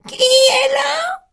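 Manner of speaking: put-on old grandmother voice. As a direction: call out like a sick old granny. Grandmother answers door.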